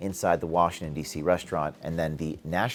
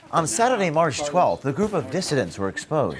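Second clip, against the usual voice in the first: reporter voice; 5 semitones higher than usual. Pretend. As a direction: announce it like a TV reporter. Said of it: "Now I'm getting into my reporter voice".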